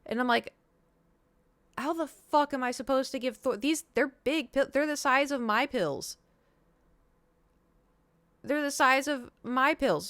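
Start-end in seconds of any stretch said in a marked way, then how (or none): 1.8-10.1 high, slowing, incredulous complaint; exasperated disbelief. Retold or imitated. Telling the vet receptionist about the pills, exasperated and high. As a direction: spell out how impossible this is. Retelling disbelief at pill size.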